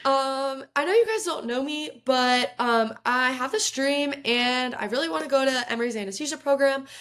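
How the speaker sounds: high, nervous introduction; a nervous rookie's pitch. Retold or imitated. Rookie nervously pitching her dream to the team. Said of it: "I nervously raise my hand".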